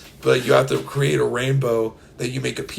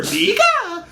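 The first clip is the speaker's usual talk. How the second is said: high squeaky Pika call; cartoon Pokemon squeak; Pikachu cry; 4 dB louder than usual. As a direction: chirp it like Pikachu.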